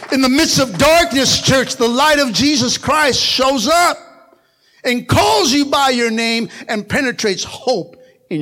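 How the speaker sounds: rising sermon cadence; preaching build. Acted.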